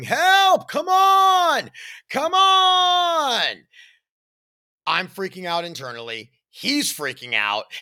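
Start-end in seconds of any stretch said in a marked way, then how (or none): 0.0-3.7 high, loud, panicked imitated yelling; stretched-out frantic screams; desperate cries for help. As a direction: cry out like a drowning man. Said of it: "I can hear my father-in-law going".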